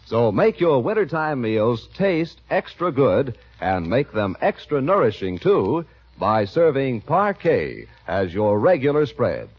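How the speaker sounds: ordinary delivery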